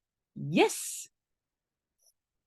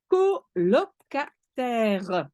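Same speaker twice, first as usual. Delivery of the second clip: colocataires spelled out slowly, syllable by syllable; teacher drawing out colocataires; slow model word; much slower than usual. Shown as a demonstration. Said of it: "teacher models the word".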